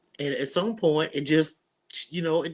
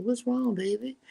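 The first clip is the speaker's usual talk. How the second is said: higher, tender mother's voice; tender mom; 8 semitones higher than usual. Retold or imitated. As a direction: gently ask what's wrong, like a worried mother. Mom comforting her crying daughter.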